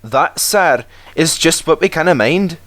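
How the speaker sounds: high Scottish engine voices; Scottish twins. A narrator's reading. Twins answer the Fat Controller in a Scottish brogue.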